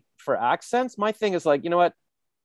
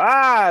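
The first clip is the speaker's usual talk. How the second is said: high, loud answering yell; comic scream back in alarm; acted scream; 11 semitones higher, 8 dB louder than usual. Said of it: "and I go".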